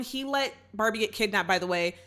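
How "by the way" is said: ordinary talk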